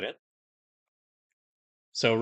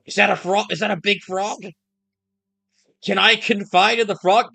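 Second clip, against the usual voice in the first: excited; 8 semitones higher, 6 dB louder than usual. Real, not acted. Surprised at a giant frog.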